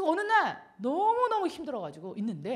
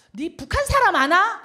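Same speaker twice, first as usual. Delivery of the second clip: louder, slower mother's question in dialect; a mother asking over the phone, louder and slower; 6 semitones higher, 11 dB louder, slower than usual. Retold or imitated. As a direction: call out like a puzzled mother. Mother's call, voiced.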